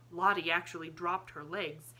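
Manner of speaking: plain reading voice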